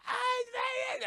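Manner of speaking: very high squealed scream; panicked kids. Retold or imitated. Retelling kids as water rushes in, mock shriek. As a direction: shriek it like kids in a flooding bus.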